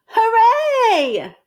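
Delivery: high, loud joyful cheer. Acted. Amelia Bedelia celebrating the tent, high and loud. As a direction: cheer with delight. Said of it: "said Amelia Bedelia".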